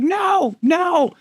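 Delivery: very high, yelled no; high pitched shouted refusal; shouting no. Retold or imitated. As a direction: yell it over engine noise. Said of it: "We're like".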